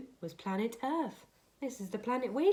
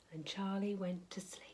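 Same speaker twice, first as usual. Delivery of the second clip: sleepy whisper; 2 semitones lower, 9 dB quieter than usual. A narrator's reading. Child falling asleep.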